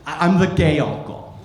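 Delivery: normal talking voice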